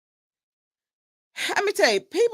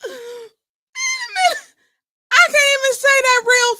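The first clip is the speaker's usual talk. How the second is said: stunned laughing shout; 14 semitones higher, 6 dB louder than usual. Real, not acted. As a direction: shout it out as if you can't believe what you heard. Laughing at the clip.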